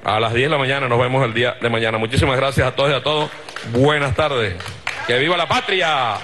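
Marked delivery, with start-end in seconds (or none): 5.1-6.2 raised rally cry. Real, not acted. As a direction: call out to the crowd. Rally chants closing the speech.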